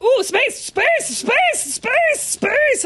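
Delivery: panicked yelps. Real, not acted. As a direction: panic as the ghost attacks. Frantic key mashing in game.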